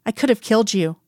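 ordinary delivery, nothing marked

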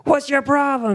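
high-pitched mock outrage; imitating an irritated victim of a prank; annoyed stranger. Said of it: "And they're like".

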